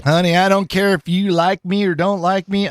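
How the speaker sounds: put-on Southern lady accent; sassy older lady in a Southern accent. Older lady telling others she doesn't care, imitated Southern accent. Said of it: "giving her a Southern accent".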